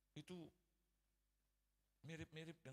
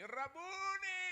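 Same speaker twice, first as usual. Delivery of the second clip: loud, high, long-held cry of joy; joyful exclamation; 9 dB louder, much slower than usual. Acted. Mary turning to the risen Jesus, joyful cry. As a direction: sing the name out high and slow in delight. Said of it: "satu ungkapan yang bersuka cita".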